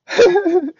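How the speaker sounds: a sudden loud laugh, pitched high. Real, not acted. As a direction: burst out laughing at yourself. Laughing at his own saying.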